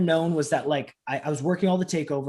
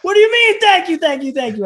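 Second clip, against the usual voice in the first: high, loud incredulous outburst; mock-outraged amazement, easing as it goes; flabbergasted; 12 dB louder than usual. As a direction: shout the thanks back in disbelief. Astonished at legend thanking him.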